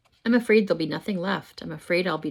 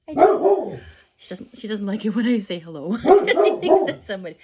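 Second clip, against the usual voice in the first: laughing explanation; 4 semitones higher, 5 dB louder than usual. Real, not acted.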